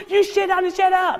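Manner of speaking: high-pitched sideline yelling; mock scream. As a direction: imitate a parent screaming at people. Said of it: "you screaming at the people".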